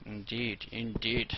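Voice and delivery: lightly voiced little alien; playful hint of an alien talking to itself. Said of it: "my little alien voice self".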